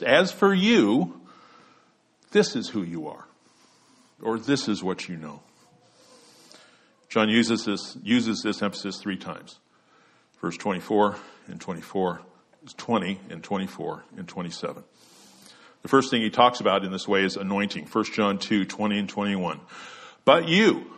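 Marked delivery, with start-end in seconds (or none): 0.0-1.2 slow, high-pitched stress stretching "as for you"; emphatic AS FOR YOU. Shown as a demonstration. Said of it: "with the emphasis intended". 20.2-20.9 "you" pitched high and louder, then a pause; stress on YOU. Shown as a demonstration. Demonstrating the emphasis in scripture.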